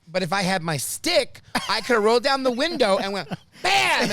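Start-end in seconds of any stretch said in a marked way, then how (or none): 3.6-4.1 high, loud comic "bam"; mock strike. Driver imagines hitting a reckless driver, loud mock strike. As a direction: punch it out like a blow landing. Acts out swinging the stick.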